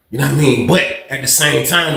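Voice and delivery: usual speaking voice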